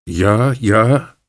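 mildly excited, lifted voice; excited call. A narrator's reading. Small mustached man answers Kroner in German, excitedly calling out. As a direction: call back with a touch of excitement. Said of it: "heyecanla seslendi".

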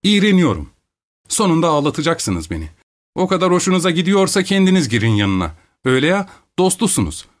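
put-on disgusted young wife. A narrator's reading. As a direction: voice her as near tears of annoyance.

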